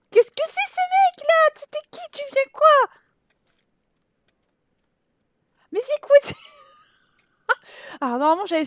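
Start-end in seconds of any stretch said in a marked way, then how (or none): 0.1-6.3 high-pitched surprised character, much higher; squeaky character voice. Pretend. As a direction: play a tiny squeaky Sim. Voicing game characters, surprised. (voix de personnage aiguë et étonnée)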